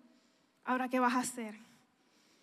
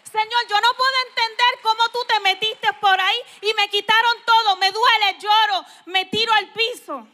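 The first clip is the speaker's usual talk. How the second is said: higher, loud anguished cry to God; loud, higher, grieving prayer to the Lord; 5 semitones higher, 10 dB louder than usual. Retold or imitated. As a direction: pour out the pain, almost weeping.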